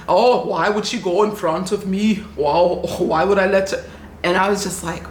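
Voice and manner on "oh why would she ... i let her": put-on South African accent, affronted; hammed-up indignation in a South African accent; mock indignant South African. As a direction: imitate an entitled South African traveler complaining.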